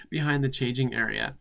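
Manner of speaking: normal talking voice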